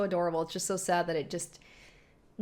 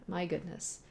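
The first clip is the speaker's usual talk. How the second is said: ordinary talk, nothing marked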